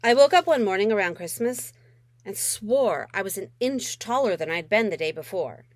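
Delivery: usual speaking voice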